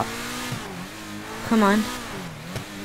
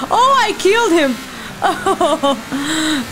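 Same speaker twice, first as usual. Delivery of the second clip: laughing; 3 semitones higher, slower than usual. Real, not acted. Player laughing after killing Beverly. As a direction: laugh it out in disbelief.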